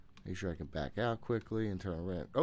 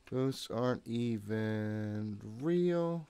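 slow, stretched self-reassurance; drawn out; 3 semitones higher, much slower than usual. Real, not acted. Player reassuring himself, slowly drawing it out. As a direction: drag every word out to calm down.

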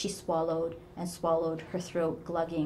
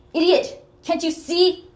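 screamed very high and loud; furious shriek; 12 semitones higher, 13 dB louder than usual. Acted. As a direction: spit the insult at her. Mother insults daughter.

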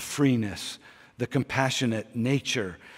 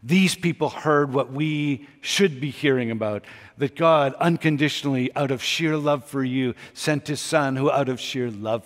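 louder, pressing ahead, full of conviction; fervent preaching; 4 semitones higher, 8 dB louder than usual. Real, not acted. Preacher proclaiming God's love to the congregation, louder and fervent. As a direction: lift it and drive it home.

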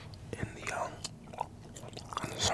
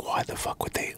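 ordinary delivery

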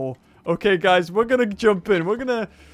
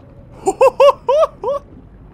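delighted high laugh; 12 dB louder than usual. Real, not acted. Seeing the huge shark.

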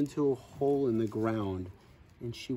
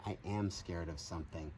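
soft, low secret admission, softer; confiding whisper; 6 semitones lower, 8 dB quieter than usual. Acted. Grandma shyly confides her fear, soft and low. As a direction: lower and softer, sharing a secret.

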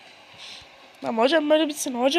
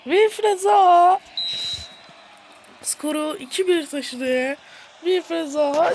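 high, loud commentary ending in an anguished cry; excited commentator; 10 semitones higher, 6 dB louder than usual. Pretend. Gamer commentating a near goal, crying out as it misses. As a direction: call the miss with real heartbreak.

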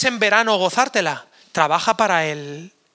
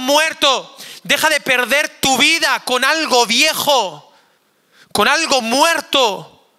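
louder, higher and slowing toward the end; impassioned preaching; 4 semitones higher, 4 dB louder than usual. Acted. Pastor pleading with teenagers to stop living for themselves, impassioned. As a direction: plead with teens, building in force.

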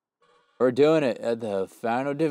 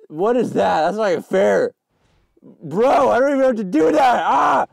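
loud, high protest building to a scream; outraged yelling; 10 semitones higher, 8 dB louder than usual. Real, not acted. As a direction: complain at the top of your voice. Protests unfair game obstacle.

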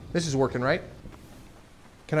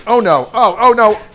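loud, higher "oh no"; panicked outburst; 5 semitones higher, 11 dB louder than usual. Real, not acted. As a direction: cry out as the fight goes wrong. Panicking in a game fight.

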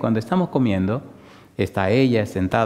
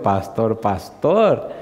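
echoing her call; much slower than usual. Retold or imitated. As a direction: back in his own register, rising on the second. His own reply to the wife.

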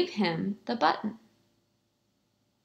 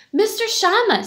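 shocked; 10 semitones higher, 4 dB louder than usual. A narrator's reading. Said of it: "I'm shocked".

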